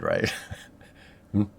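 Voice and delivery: ordinary delivery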